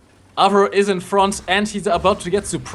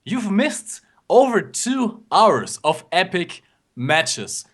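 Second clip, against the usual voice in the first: slow dramatic announcer; slower than usual. Acted. Playful crowd address, slowed.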